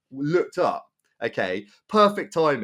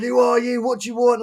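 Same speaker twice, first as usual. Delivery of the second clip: high, hammed-up challenge; imitated angry guy; 9 semitones higher, 3 dB louder than usual. Van driver demands who the onlooker is, mock angry. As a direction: mimic a belligerent driver, voice pitched right up. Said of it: "the angry guy".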